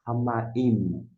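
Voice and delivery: Ramaim with every sound marked, final M held; careful Ramaim. Shown as a demonstration. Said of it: "se você quiser marcar".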